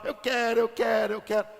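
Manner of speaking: mock childish begging on one held pitch; mock crying plea. Preacher imitating people crying to God, mock crying plea. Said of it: "A gente chora".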